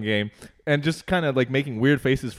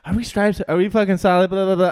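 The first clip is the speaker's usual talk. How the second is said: higher-pitched mock nagging, trailing into blah-blahs; mocking chatter; 5 semitones higher, 5 dB louder than usual. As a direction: imitate her pestering, higher and louder. Mimicking the bothersome opponent's chatter.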